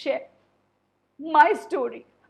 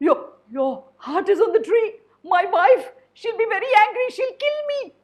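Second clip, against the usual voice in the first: high, loud, panicky stammer; a scared crocodile fearing his wife; panicked crocodile; 9 semitones higher, 8 dB louder, faster than usual. Acted. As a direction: squeal in panic about the angry wife.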